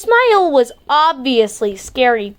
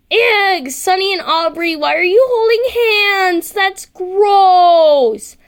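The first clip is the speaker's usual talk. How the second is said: high, loud disgusted whine; grossed-out girl; 7 semitones higher, 3 dB louder, slower than usual. Acted. Girl grossed out by Sonny and Aubrey holding hands, high disgusted whine. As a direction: squeal your disgust at the couple.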